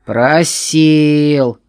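slow, loud ominous "просил"; sinister voice. A narrator's reading. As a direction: spell it out like a threat. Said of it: "стал зловещим голосом перечислять".

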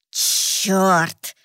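hissed curse through the teeth; hissing feral vampire. Acted. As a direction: hiss it like a wounded beast. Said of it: "прошипел вампир".

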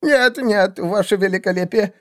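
very high, squeaky denial; insistent. A narrator's reading. Assassin denies cowardice to the seer, insistent. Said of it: "настаивал Сумеречный Клык".

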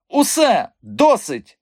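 high, loud furious outburst; seething. A narrator's reading. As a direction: cut him off with a boiling shout. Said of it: "Мадам Бухенбах аж кипіла". (розлючений високий вигук)

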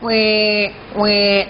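put-on drawn-out baby "wek"; mock infant wail; imitated baby crying. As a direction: mimic a hungry infant's bleat. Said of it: "Lapar je menangis".

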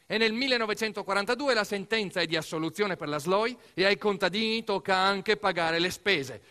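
ordinary delivery

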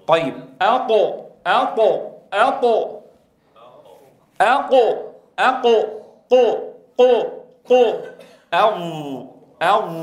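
teacher drilling elevated letters. Shown as a demonstration. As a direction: repeat أقو building up, then أغ. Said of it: "قل معي".

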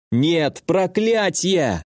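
slow, high angry growl. A narrator's reading. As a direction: drag out the curse in anger. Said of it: "зарычал я".

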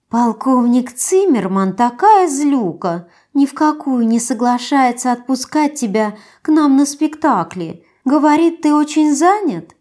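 high, slow pouting coo; whiny spoiled pouty drawl. Acted. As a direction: sulk sweetly, drawing it out. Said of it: "Она капризно надула губы".

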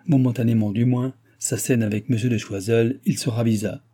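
ordinary delivery

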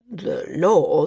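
hesitant, heavy voice; the landsman reluctant to rule, troubled; slow, troubled. A narrator's reading. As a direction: weigh the words unwillingly. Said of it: "The landsman's voice was slow, troubled".

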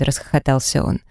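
usual speaking voice

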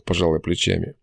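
usual speaking voice

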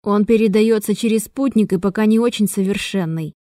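usual speaking voice

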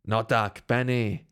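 mildly exclaimed disbelief; a lightly voiced, incredulous fiddler; incredulous cry. A narrator's reading. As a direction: protest in disbelief, pitched a little down. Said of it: "vykřikl nevěřícně houslista".

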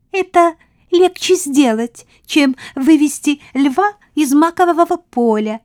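high, bright queen mouse. A narrator's reading.

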